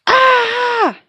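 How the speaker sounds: very high loud shriek; a piercing surprised scream; startled scream. Acted. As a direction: scream in shock.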